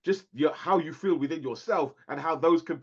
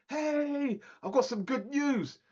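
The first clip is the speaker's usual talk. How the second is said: daughter's voice; 9 semitones higher, 3 dB quieter, slower than usual. Retold or imitated. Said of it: "she phones up and goes like".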